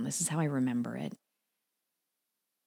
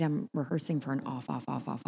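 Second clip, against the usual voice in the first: usual speaking voice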